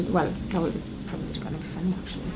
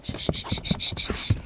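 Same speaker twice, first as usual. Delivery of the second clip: whispered shushing. Real, not acted. Hushing the baby.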